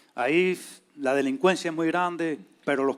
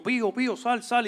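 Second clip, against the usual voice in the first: calling out; 7 semitones higher, 3 dB louder than usual. Retold or imitated. Calling friends in the dark.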